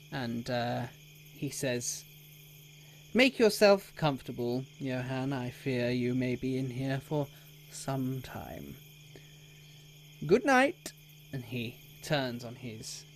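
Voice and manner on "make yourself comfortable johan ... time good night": lightly voiced mock courtesy; smug townmaster. Townmaster leaves Johan in the cell, mock courteous.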